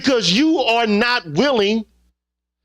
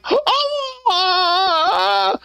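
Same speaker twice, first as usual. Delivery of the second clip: imitating a woman's mock whimpering, pitched up; mock crying; 9 semitones higher, slower than usual. Man mocking a woman faking tears in front of others. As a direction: whimper in mockery of fake tears. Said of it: "act like".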